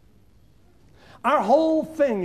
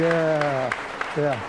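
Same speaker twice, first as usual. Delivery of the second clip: normal talking voice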